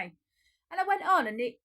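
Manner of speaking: excited. Real, not acted. Excited retelling.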